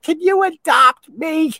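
put-on high timid plea from a girl; squeaky girl voice. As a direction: play a timid young woman asking. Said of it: "and then she's like".

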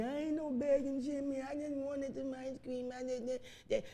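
put-on whining child. Child protesting being called a begging Jenny. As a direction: whimper it like a hurt little kid. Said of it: "Mom has called you a begging Jenny".

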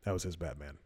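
low, soft hero voice; Batman voice. Retold or imitated. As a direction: drop low and quiet like Batman. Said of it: "shift his voice downward".

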